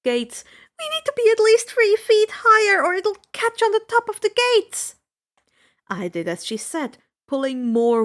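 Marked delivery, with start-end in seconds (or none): 0.8-5.0 loud, high, pressing; urgent shout. A narrator's reading. Friend warning over rushing water, loud and urgent. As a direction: yell it fast before it's too late.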